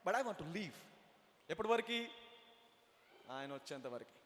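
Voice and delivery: ordinary delivery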